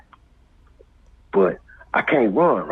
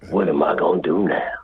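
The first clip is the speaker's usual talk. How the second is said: ordinary talk, nothing marked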